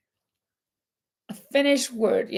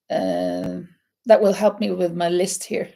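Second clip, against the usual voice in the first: ordinary talk, nothing marked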